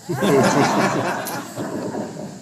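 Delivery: amused chuckle at own joke; laughing. Real, not acted. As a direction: laugh at your own punchline. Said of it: "Make myself laugh".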